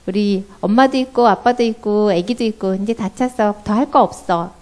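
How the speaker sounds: put-on loud, bossy refusal of a child leader; bossy leader kid. Seongwon refuses a child wanting to join, bossy. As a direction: shut the newcomer out like a bossy kid. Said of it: "그러면 성원이가".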